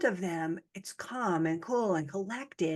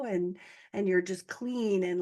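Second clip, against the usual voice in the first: ordinary talk, nothing marked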